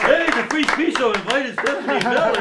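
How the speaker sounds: high, loud hype-man shout; mock excited announcement. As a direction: shout it like breaking news, half laughing. Host mock-announces his invitation as huge news.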